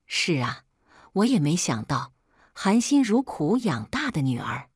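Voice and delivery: plain reading voice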